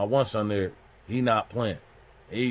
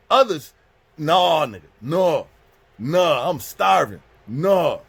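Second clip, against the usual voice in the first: loud, high repeated refusals; angry refusal; 5 semitones higher, 7 dB louder, much slower than usual. Retold or imitated. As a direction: cut him off, outraged and loud. Angry refusal of plea to calm.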